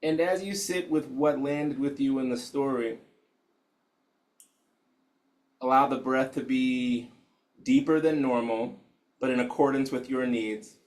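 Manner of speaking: hushed, unhurried guide settling the room; guided meditation. Acted. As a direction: ease them into stillness gently.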